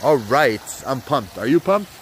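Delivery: ordinary talk, nothing marked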